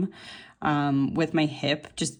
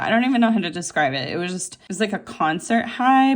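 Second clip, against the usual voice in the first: usual speaking voice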